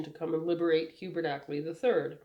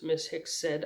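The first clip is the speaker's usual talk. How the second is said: ordinary delivery, nothing marked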